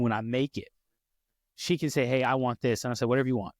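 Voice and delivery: normal talking voice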